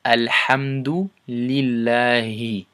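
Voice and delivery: Alhamdulillahi said slowly with a falling end; slow recitation demo. Shown as a demonstration. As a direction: model it again slowly. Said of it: "One more time".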